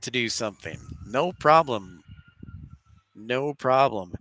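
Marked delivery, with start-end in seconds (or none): none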